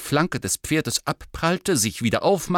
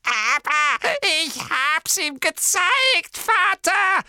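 high, slow cackling taunt; 6 dB louder, slower than usual. A narrator's reading. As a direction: cackle it out gleefully. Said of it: "keckerte Morphin".

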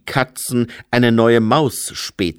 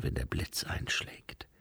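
whisper; 14 dB quieter than usual. Acted. Lucé quiet, ominous.

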